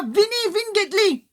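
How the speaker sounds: high, loud eager call; Jesus calling the beggar to come. Acted. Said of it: "il dit comme ça".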